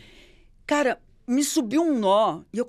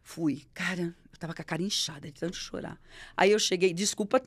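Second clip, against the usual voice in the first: ordinary talk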